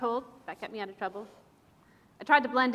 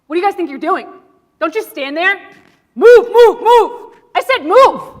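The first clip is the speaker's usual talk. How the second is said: much higher, louder commanding yell; yelling at recruits; 8 semitones higher, 19 dB louder, slower than usual. Acted. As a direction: bellow the order to move. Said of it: "You yell at them?".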